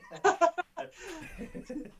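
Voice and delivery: high burst of laughter; real high-pitched giggling fit; laughing. Real, not acted. Newcomer laughing on coming on air, high laughter.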